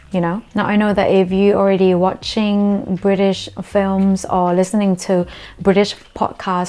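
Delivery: ordinary talk, nothing marked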